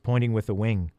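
plain reading voice

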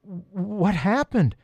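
slow, high-pitched bewildered question; dazed question. A narrator's reading. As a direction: ask it slowly, coming round after a fall. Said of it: "asked Peter, shaking the water out".

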